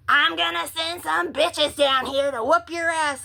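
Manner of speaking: high-pitched mock threat; angry threat. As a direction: imitate a worked-up stranger threatening a fight. Man in car threatens her.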